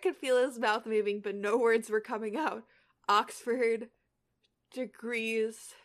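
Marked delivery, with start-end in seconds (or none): 3.1-5.7 halting, stammered word by word; lame finish. A narrator's reading. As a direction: trail into a lame, slow finish. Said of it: "he finished lamely".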